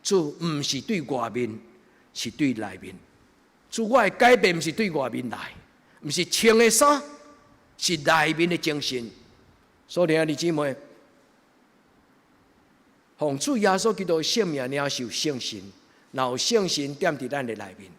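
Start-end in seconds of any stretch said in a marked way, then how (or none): 3.7-13.6 preaching. Acted. Preaching at full force.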